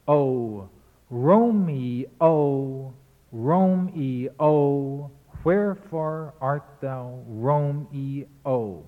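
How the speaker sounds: "Romeo" slowly drawn out as Ro-me-o; slow recital, giving "Romeo" all three syllables. Retold or imitated. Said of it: "Marlowe says the line in three syllable".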